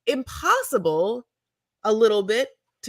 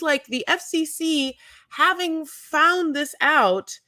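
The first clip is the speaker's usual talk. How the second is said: normal talking voice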